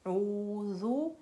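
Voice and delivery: ऊज़ु drawn out very slowly; slow drawn demo. Shown as a demonstration. Teacher slowly showing students the word: ऊज़ु drawn out. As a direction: stretch ऊज़ु long and clear. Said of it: "Hamza का यहाँ मतलब है मैं".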